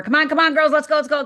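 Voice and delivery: put-on hollering for the kids to hurry up; dad calling out. Dad hurrying daughters out the door, calling loudly.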